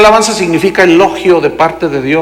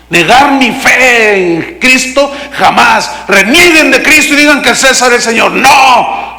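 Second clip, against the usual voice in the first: raised, higher-pitched acted defiance; defiant declaration; 5 semitones higher than usual. Martyrs in the Coliseum refusing to deny Christ, defiant cry.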